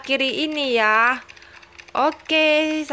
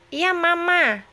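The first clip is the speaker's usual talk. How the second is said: put-on baby voice; 4 semitones higher, slower than usual. Baby Tom Tom answers mama.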